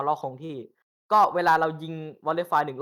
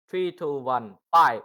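slow, drawn-out, louder countdown call; volley countdown; 5 dB louder, much slower than usual. Shown as a demonstration. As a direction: call the fire order to the line. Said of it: "สมมุติเรายิงไปปุ๊บ".

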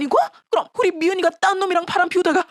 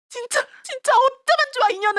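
anguished; 9 semitones higher than usual. Acted. Mother scolds daughter in anguish.

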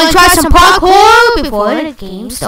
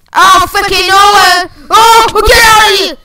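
loud, high furious shouting; raging gamer; 7 semitones higher, 6 dB louder than usual. Real, not acted. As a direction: yell at the cheap attack. Rage at surprise attacker.

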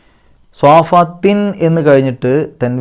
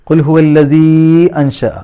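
louder, higher chant; Quran recitation; 4 semitones higher, 5 dB louder, slower than usual. Shown as a demonstration. Teacher recites the verse with the long vowel. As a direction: stretch the അല്ലസീ as you chant. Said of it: "അല്ലസീ എന്നുള്ള ആ ഒരു നീട്ടൽ".

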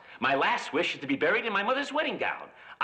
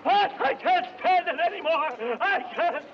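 hammed-up heat madness; 9 semitones higher, 4 dB louder than usual.

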